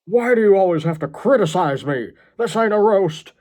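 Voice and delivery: high, loud put-on wail; hammed-up indignant cry; hurt, outraged John. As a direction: protest like a wounded madman.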